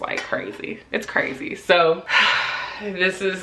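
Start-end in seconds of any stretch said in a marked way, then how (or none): 0.0-1.5 hushed, breathless whisper; quiet awed whisper. Real, not acted. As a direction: whisper it in awe. Overwhelmed by found keepsake.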